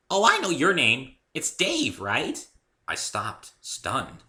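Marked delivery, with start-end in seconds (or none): 0.1-2.5 put-on much higher, louder giddy pony girl; bubbly Pinkie Pie. A narrator's reading. Cheerful pony introducing herself to Dave, high and bubbly. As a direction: chirp it out like an overexcited pony.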